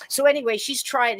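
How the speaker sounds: normal talking voice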